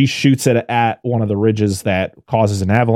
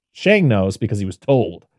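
ordinary delivery

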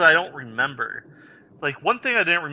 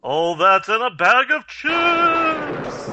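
slow silly voice; 6 semitones higher, 3 dB louder, much slower than usual. Pretend. Spooky pun riffing.

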